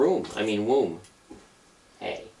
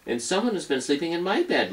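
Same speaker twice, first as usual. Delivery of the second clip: higher medium-sized bear voice; higher exclaiming Mama Bear; 10 semitones higher, faster than usual. Acted. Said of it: "exclaimed in her medium-sized voice".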